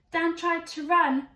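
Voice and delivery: plain reading voice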